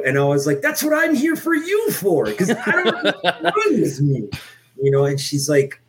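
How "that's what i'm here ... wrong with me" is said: high laughing squeal; laughing reply. Retold or imitated. To the therapist, joking through high laughter. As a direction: laugh through the punchline in a much higher voice.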